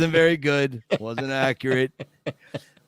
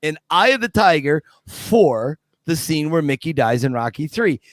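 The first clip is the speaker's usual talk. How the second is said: ordinary delivery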